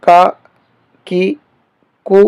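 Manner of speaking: کا، کی، کو said thin and quick; light kaaf. Shown as a demonstration. Teacher contrasting the two k letters, light crisp kaaf. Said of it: "اس کاف کو بریک پڑھیں گے".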